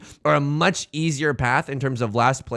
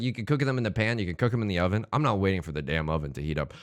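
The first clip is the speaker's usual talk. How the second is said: usual speaking voice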